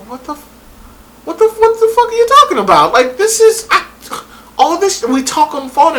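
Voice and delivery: mock his outrage, voice shooting up; outraged guy. Guy's outraged reaction, acted.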